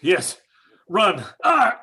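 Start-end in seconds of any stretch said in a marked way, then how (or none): 1.4-1.8 high, loud cry of fright; scream. Acted. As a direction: shriek while running from flames. Fleeing the fire, scream.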